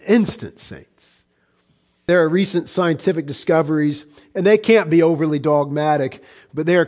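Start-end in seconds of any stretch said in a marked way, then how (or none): 0.6-1.3 breathy whispered "saints.". Real, not acted. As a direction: drop to a hush for "saints.". Whispered address to congregation.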